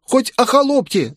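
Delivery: put-on very high-pitched voice of a crying boy; tearful pleading. A narrator's reading. Street boy begging a lady to take him into service, tearful. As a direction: plead desperately in a high, whining child's voice. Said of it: "заплакал он".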